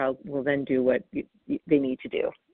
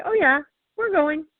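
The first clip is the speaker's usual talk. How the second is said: bright, much higher, louder upbeat brush-off; breezy cheerful; 10 semitones higher, 4 dB louder than usual. Shown as a demonstration. Parent to reluctant child, breezy and upbeat. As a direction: sound cheerfully unbothered, like you're already leaving. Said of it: "Just say".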